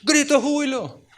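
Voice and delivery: high, loud churchy shout of joy; a hammed-up cry of praise; mock joyful shout. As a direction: parody the congregation's shout.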